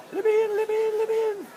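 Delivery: very high, loud, insistent fish; loud squeaky fish voice. Acted. Dad voicing a fish for his kids, high and loud. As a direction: shout to be let in like a tiny fish.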